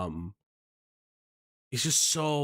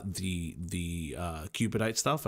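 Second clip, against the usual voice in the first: normal talking voice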